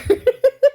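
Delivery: loud high laugh bursting out; burst of high laughter. Real, not acted. Laughing at own joke.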